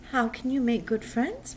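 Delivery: ordinary talk, nothing marked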